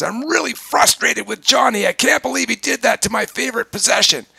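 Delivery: high, loud, upset complaint; frustrated person venting. Shown as a demonstration. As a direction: play someone fed up and venting. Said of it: "walks in the room and says".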